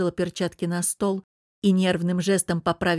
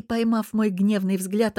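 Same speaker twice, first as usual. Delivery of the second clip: ordinary delivery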